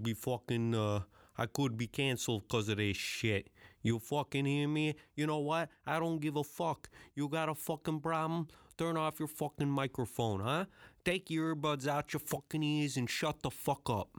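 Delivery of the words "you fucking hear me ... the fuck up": mock Italian mobster accent, higher pitched; a weak Italian wise guy telling off the listener; Italian tough-guy accent. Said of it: "that's not even a great accent".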